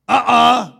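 high, loud doubting grunt; big man's flat denial. Retold or imitated. Big preacher refusing to believe he's Baptist, high and loud. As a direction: shake your head at him out loud.

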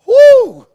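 high shouted woo; joyful whoop. Real, not acted. Preacher caught up in the story, loud happy woo.

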